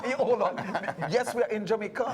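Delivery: high and loud through real laughter; cracking up as the joke lands; laughing. Real, not acted. Host laughs at the Jamaican accent joke, laughing loudly. Joking they are in Jamaica.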